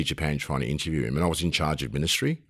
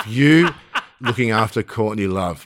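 words pushed out through real laughter; laughing; 3 semitones higher, 4 dB louder, slower than usual. Real, not acted. Host can't believe his guest's job, laughing. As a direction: laugh your way through the line.

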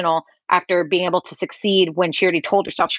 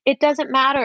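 mock upbeat positivity; 6 semitones higher than usual. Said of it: "in the sense of, like".

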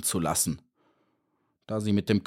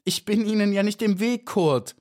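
high, pleading little bee voice; calling out; 10 semitones higher than usual. A narrator's reading. Trapped bee calls to the beetle below, high urgent call. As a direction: call down to him, pitched high. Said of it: "rief Maya".

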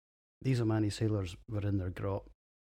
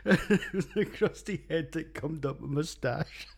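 high, slow words through laughter; fighting back giggles while talking; laughing; 12 semitones higher than usual. Real, not acted. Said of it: "I've just about stopped laughing".

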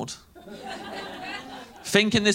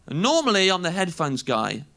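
ordinary talk

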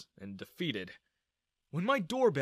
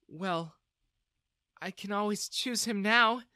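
flirtatious; 6 semitones higher than usual. A narrator's reading. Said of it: "Jessica smiled".

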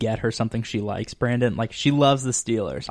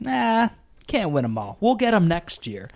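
higher, mock carefree dad voice; easygoing dad; 8 semitones higher, 3 dB louder than usual. Dad after every lost game, breezily unbothered. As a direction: shrug the loss off, imitating dad. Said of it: "He's like".

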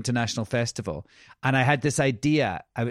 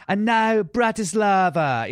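announcer; 7 semitones higher, 5 dB louder, slower than usual. Retold or imitated. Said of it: "I was going".

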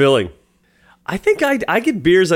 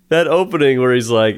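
usual speaking voice

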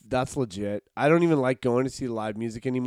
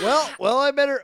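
high, loud hurried excuse; mock polite exit; 12 semitones higher, 6 dB louder than usual. Man trying to escape a conversation, high loud excuse. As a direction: edge your way out of the talk. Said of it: "You're like".

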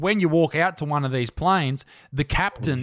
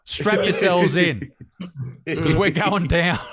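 mock rude pilot; 3 semitones higher than usual. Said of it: "They're like".

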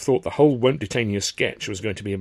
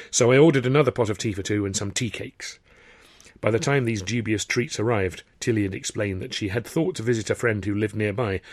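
ordinary talk, nothing marked

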